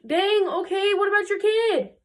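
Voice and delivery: high-pitched incredulous outburst; exasperated, squeaky astonishment; mock disbelief. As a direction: call out the careless mother, voice way up. Narrator scolds the indifferent mother.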